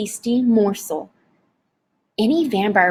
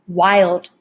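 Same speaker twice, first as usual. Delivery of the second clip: usual speaking voice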